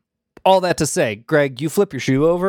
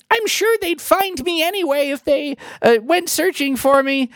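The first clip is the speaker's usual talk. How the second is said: pitched way up, wistful little fairy; high fairy voice; 12 semitones higher than usual. Shown as a demonstration. GM redoing the fairy's line in the right high voice. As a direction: redo it high and sighing. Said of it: "I gotta dial in this voice".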